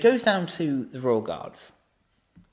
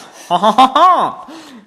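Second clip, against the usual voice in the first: loud, high, drawn-out cackle; triumphant laugh; 13 semitones higher, 13 dB louder, much slower than usual. Real, not acted. Gloating over a won bet, triumphant laugh. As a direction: gloat with a big slow laugh.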